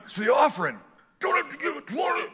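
hammed-up friend in the pew; buddy Bob. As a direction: protest like a stunned friend. Buddy whispered argument in church.

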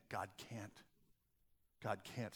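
very hushed and low; unspoken doubt. Acted. Preacher voicing what our actions say silently, very quiet. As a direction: barely voice it, an inner confession. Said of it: "We don't say it out loud".

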